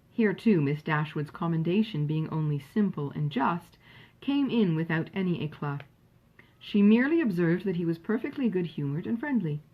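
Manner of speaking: usual speaking voice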